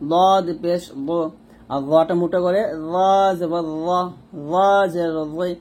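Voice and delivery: usual speaking voice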